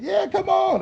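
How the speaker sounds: very high, drawn-out yeah. Retold or imitated. Snoop urging him to roll up, high breezy yeah. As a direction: sing the yeah up high and easy. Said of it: "Snoop answering on plane".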